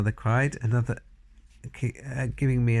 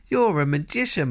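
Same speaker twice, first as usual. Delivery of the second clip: louder, high praise flung out; excited shout; 7 semitones higher, 4 dB louder than usual. A narrator's reading. Grandmother cheering the boy's idea, excited shout. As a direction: hail him like a wonder. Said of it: "my grandmother shouted".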